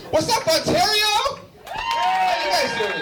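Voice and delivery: big, slow, high hype-up shout to the room; hyped crowd greeting. Real, not acted. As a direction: work the crowd up, drawn out. Comedian greeting crowd loudly.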